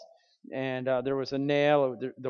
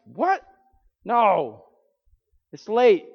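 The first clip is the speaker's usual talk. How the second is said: startled protest; 6 semitones higher, 8 dB louder, much slower than usual. Retold or imitated. Wife objects to midnight trip.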